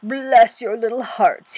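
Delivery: loud, slow, effusive fox; crying out. Acted. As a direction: gush it out, high and drawn-out. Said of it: "he cried".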